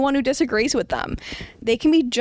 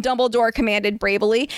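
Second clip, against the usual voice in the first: usual speaking voice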